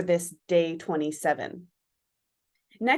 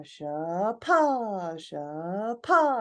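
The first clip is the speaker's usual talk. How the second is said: weighted swinging sha-pa; much slower than usual. Shown as a demonstration. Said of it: "that weightedness in the middle".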